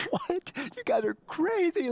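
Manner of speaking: put-on very high, squeaky disbelief as an old woman; hammed-up high-pitched scoffing; mock incredulous laughing. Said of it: "Sarah starts laughing".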